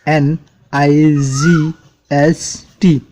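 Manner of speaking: slow letter-by-letter spelling; teacher spelling a word slowly for the class. Shown as a demonstration. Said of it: "night".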